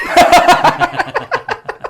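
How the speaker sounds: loud high-pitched burst of laughter. Real, not acted. Laughing at kid's line.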